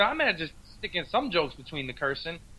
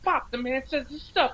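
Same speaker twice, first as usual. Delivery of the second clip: Cosby voice, pitched up; imitating Cosby, high voice; 6 semitones higher, 4 dB quieter than usual. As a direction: do a raised Cosby voice.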